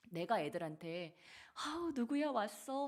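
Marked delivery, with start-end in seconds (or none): 1.5-2.9 much higher, louder delighted welcome; high, fond hello to a child; affectionate greeting to kids. Shown as a demonstration. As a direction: gush over a child arriving. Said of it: "하고 안아주고 사랑해주고 친절하게".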